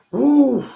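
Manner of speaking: loud high whoop. Real, not acted. Cheering after snapping out of a high, loud whoop. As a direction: let out a big joyful whoop. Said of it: "I snapped out of it".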